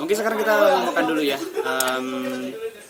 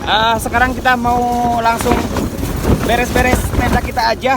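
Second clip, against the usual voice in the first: raised voice over a storm; shouting over wind; 4 semitones higher, 4 dB louder than usual. Real, not acted. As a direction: call out over howling wind. Holding tent in strong wind.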